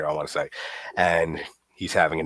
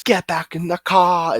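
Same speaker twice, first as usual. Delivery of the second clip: much higher, slow, hammed-up warning; mock the overacted British delivery; overdramatic line; 10 semitones higher, 4 dB louder than usual. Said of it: "very overdramatic".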